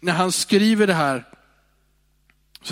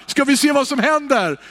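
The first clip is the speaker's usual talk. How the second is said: ordinary delivery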